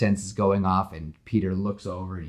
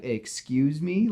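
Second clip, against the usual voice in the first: slow, higher, drawn-out indignant question; offended excuse me; 7 semitones higher, slower than usual. Retold or imitated. Gwen hears Peter's bad joke, slow offended question. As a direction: stretch it out, affronted. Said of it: "Gwen's face is like".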